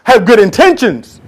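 building earnest protest; earnest plea. Acted. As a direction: plead your good intentions. Preacher voicing earnest believer.

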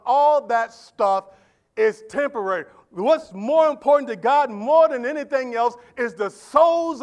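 loud, high, rousing sermon delivery; shouted, fervent preaching; full-force preaching. Acted. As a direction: preach it out to the whole church.

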